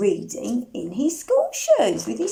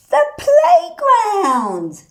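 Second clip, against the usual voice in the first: excited reveal; 10 semitones higher, 7 dB louder, slower than usual. Acted. Revealing answer to children.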